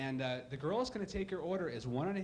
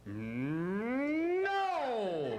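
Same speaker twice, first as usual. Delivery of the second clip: mock refusal; 4 semitones higher than usual. Agriculture major refusing sex.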